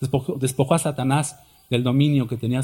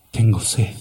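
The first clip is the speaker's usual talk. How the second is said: voice of Jesus; 5 semitones lower than usual. Acted. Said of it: "el Señor Jesucristo respondió y dijo".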